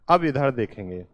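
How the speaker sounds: ordinary delivery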